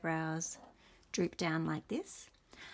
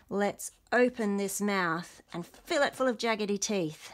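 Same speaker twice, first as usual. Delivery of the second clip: ordinary delivery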